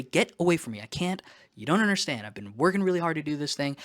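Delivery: exasperated pleading. Retold or imitated. To people interrupting his diet, exasperated mock protest. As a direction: push them away, frustrated and pleading. What you want to say.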